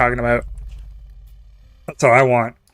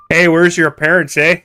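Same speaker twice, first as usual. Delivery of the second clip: Canadian; 5 semitones higher, 4 dB louder than usual. Retold or imitated. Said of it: "they'd just be asking where your parents are".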